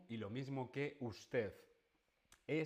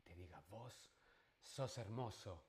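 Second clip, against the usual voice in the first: much softer, almost murmured; soft aside; 2 semitones lower, 8 dB quieter than usual. Shown as a demonstration. Teacher quietly repeating the voseo example to learners. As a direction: drop the voice right down for the example. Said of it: "quiet repeat".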